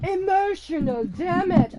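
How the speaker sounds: slow meme voice, different timbre; second go at the meme voice; emotional damage meme voice. Retold or imitated. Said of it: "I'm not good at doing his voice accent anymore".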